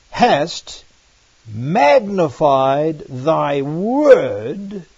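slow, solemn reading of the psalm; measured, reverent recitation; scripture reading. Acted. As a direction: draw out each phrase like a holy proclamation.

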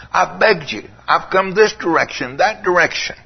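ache over each how many times; anguished plea. Retold or imitated. Jesus pleading with those who turned away, in anguish. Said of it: "He said".

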